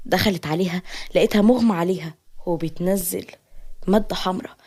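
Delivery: usual speaking voice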